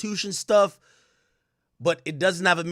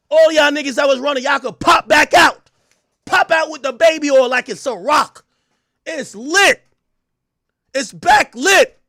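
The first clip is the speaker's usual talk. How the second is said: hyped shouting; 7 semitones higher, 9 dB louder than usual. Real, not acted. Streamer shouting at people who ran to pop back out. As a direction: shout them out of hiding.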